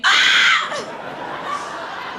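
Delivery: high, loud fake scream as skin is pulled; mock scream. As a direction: shriek like being dragged through fabric. Screaming being pulled through screen.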